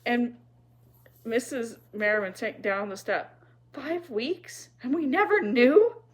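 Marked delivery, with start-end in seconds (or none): 3.7-6.0 high, rising disbelief, louder by the end; can't believe it was kept secret; astonished. A narrator's reading. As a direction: build to a loud, shocked question.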